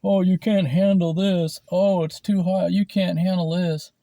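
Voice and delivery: high, loud mocking taunt; mocking hot sauce bro. Mocking the hot sauce guys, high and loud taunt. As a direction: sneer it like a macho show-off. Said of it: "They're like".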